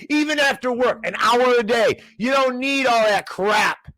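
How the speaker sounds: real heated, high-pitched rant; angry rant. Real, not acted. Host going off on the schools to listeners, angry rant. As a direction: go off on a furious high-pitched tirade. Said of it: "sorry, went off".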